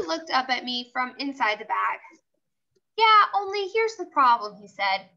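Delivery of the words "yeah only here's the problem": lightly voiced stuffed elephant, raised and louder; a toy elephant pointing out a problem. Teacher reading aloud, voicing Philip Johnny Bob, lightly raised.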